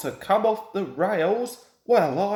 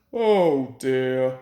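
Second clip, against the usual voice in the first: dismayed; 7 semitones lower, 4 dB louder than usual. A narrator's reading. Gordon despairing in the ditch.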